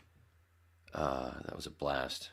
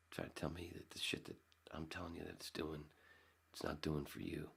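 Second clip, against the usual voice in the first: whisper; 9 dB quieter than usual. Real, not acted. Complaining about mechanics.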